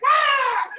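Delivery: high, loud shriek; startled scream. Retold or imitated. Storyteller imitating the startled girls, high scream. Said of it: "And they're like".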